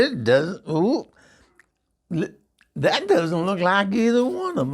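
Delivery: high, loud shocked complaint; dismayed protest. Retold or imitated. [name] protests to the tattoo artist, shocked. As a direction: sputter in disbelief. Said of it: "I said".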